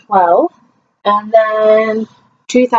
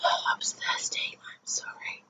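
breathy whispered aside after a drink; 19 dB quieter than usual. Real, not acted. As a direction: whisper it, half to yourself.

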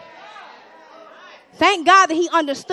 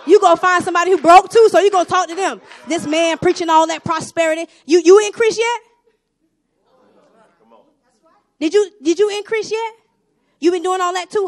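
loud, rising preacher's call; preaching at full force; 5 semitones higher, 8 dB louder than usual. Acted. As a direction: push it out to the congregation like a pulpit cry.

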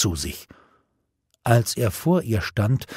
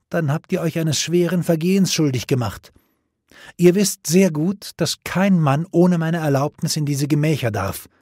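high, imperious pronouncement; stern, lofty royal order; haughty command; 6 semitones higher than usual. A narrator's reading. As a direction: pass sentence as an offended queen. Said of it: "sagte sie hochmütig".

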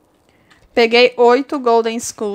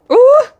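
very high, loud "uh"; sudden high whoop of alarm; startled yelp; 9 dB louder than usual. Real, not acted. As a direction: yelp as the move nearly fails. Near miss during game race.

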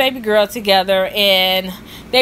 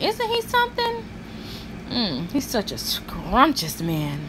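swooning; 9 dB quieter than usual. Acted. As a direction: swoon over the handsome husband. Swooning over the husband.

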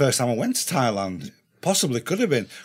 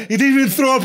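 ordinary delivery

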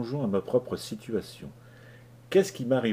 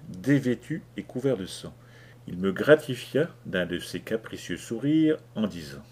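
ordinary delivery

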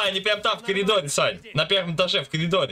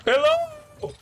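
shouted hello. Real, not acted. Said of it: "я ему ору, блядь, hello".

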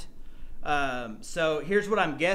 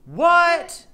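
high, loud burst of disbelief; can't believe what he just read; stunned what; 13 semitones higher, 10 dB louder than usual. Real, not acted. Reading the medal's inscription.

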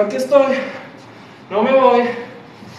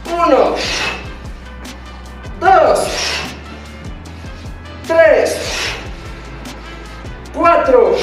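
barking gym coach; 5 semitones higher, much slower than usual. Acted. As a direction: bark out each rep to the class. Coach counting reps in class.

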